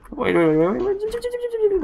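escalating excited wait. Real, not acted. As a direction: stretch the last wait into a high squeak.

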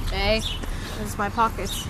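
normal talking voice

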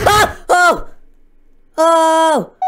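high, loud yelps of shock; startled shouts. Real, not acted. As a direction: jolt out each oh in fright. Startled by game scare.

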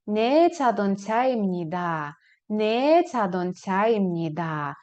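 Не чадонцяємніда slow, each syllable clear, falling; slow model answer. Shown as a demonstration. Teacher drills the answer slowly for students. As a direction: spell out Не чадонцяємніда slowly for learners. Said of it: "ви скажете".

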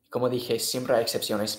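ordinary delivery

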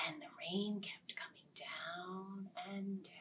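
hushed whispered rain. A narrator's reading. Storyteller whispering the rain to children.

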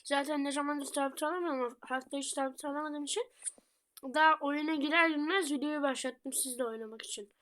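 ordinary delivery

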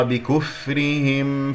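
بكفرهم slowly with a light ر and a clear م; slow careful demo. Shown as a demonstration. Teacher slowly modelling بكفرهم for the class. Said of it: "والراء مرققة والميم مظهرة".

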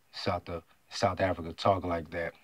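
ordinary talk, nothing marked

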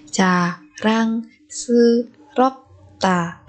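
very slow, separated "Jarangseuropta"; syllable by syllable. Shown as a demonstration. Teacher modelling a word for learners, very slowly. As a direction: space out every syllable of "Jarangseuropta".

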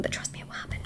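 soft, hushed whisper; whispered promise. Real, not acted. Reader confiding to viewers, hushed whisper. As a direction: breathe it out like a confidential vow.

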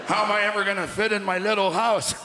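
lightly acted drunken astonishment; mock drunk dismay, wailing a little; drunk self. Drunk man marvelling at his little tie, mock dismayed. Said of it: "I was so drunk, I looked down and thought".

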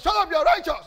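high, very loud retort; acted angry shout. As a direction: shout them down in a mock quarrel. Retold quarrel, shouting back.

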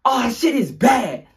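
slow, high, loud drawn call of alarm; corrected line. Retold or imitated. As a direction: drawl it out in alarm. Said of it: "all you hear is".

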